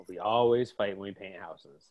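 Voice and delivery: low, subdued echo of the partner; reproachful imitation. Partner replaying how the other said it, low mocking echo. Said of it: "the way you said it".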